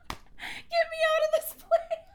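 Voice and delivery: real laughing sob; laughing crying. Real, not acted. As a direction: wail it through laughter.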